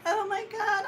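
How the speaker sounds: very high-pitched overwrought cry; hammed-up wail of relief and distress; mock breakdown. Owner back inside after scare, mock breakdown. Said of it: "had, like, a mini breakdown".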